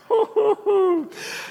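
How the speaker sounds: high burst of laughter, falling away; hearty laugh. Real, not acted. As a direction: laugh at the memory. Laughs at his own story.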